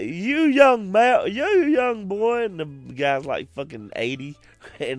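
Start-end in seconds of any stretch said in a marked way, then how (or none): 0.0-2.5 high, loud, slow mock old man; a hint of an old man. A mock old man scolding Sal, high, loud and slow. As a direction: croak it like a grumpy grandpa.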